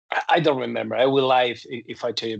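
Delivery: usual speaking voice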